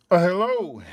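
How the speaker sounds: into a tube, echoing; talking into a tube. Pretend. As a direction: speak into the tube like a phone. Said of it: "I'm talking to the tube".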